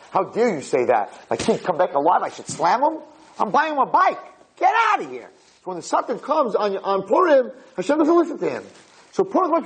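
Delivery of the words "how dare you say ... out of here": shaking with anger. Acted. Father throwing out the meddler.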